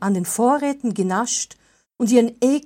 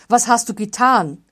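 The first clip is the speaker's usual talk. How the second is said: louder angry question; snapping at him; 3 semitones higher, 7 dB louder than usual. A narrator's reading. Wolfgang confronting Martin over the mice, louder angry question. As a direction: demand it sharply. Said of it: "fuhr er Martin an".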